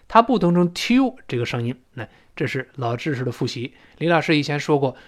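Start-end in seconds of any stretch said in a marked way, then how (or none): none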